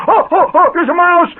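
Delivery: high, loud, laughing fake panic; hammed-up fright; mock panic. Father fakes fright at the wax mouse before the ladies, high hammed-up panic. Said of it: "and he goes".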